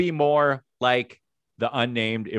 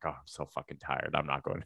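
tired; 10 semitones lower, 14 dB quieter than usual. Retold or imitated. Retold tired self.

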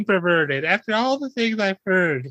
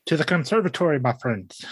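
low, grand Victorian gentleman; 3 semitones lower, faster than usual. Shown as a demonstration. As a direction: drop low and declaim like a Victorian gent. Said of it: "give me a Victorian voice real quick".

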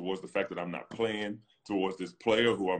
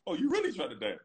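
squeaky, much higher mock surprise; mocking disbelief. Player mocking a rival who wants to fight, squeaky and high. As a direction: scoff as if he can't be serious. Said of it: "And I'm like".

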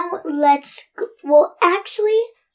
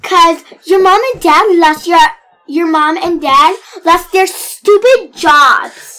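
louder, jeering; loud taunt; 3 semitones higher, 8 dB louder than usual. Acted. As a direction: rub it in her face. Player taunting the mean girl.

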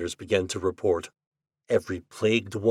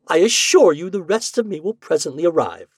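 high, loud, exasperated assurance; 8 semitones higher, 7 dB louder than usual. Acted. Half-formed ghost promises to finish appearing, exasperated. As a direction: insist in exasperation, voice raised. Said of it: "in an exasperated voice".

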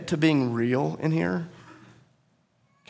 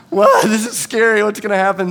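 high, loud, panicked "what?"; scared disbelief; 7 semitones higher, 8 dB louder than usual. Retold or imitated. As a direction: squeal it out like he's suddenly frightened. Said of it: "is like".

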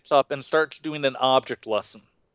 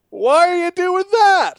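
much higher, louder, slow whiny query; heckling churchgoer; 13 semitones higher, 8 dB louder, slower than usual. Retold or imitated. Churchgoer interrupting the pastor's object lesson, high whiny heckle. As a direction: whine it like a puzzled heckler. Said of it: "someone from the church goes".

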